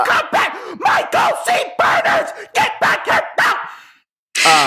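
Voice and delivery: high shouted calls, last one drawn out slowly; yelling for a kid. Pretend. Parent yelling the runaway kid's full name, high and drawn out. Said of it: "get really good at yelling".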